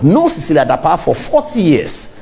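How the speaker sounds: normal talking voice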